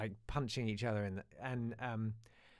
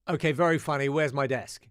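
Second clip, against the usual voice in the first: annoyed teacher; 2 semitones higher, 10 dB louder than usual. Retold or imitated. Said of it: "He was annoyed".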